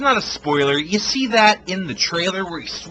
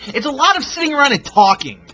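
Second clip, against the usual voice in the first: raised, fast exasperated complaint; exasperated rant; 9 semitones higher, 7 dB louder, faster than usual. Real, not acted. Fed-up reviewer griping about endless talking scenes, raised and fast. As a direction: vent it, louder and faster.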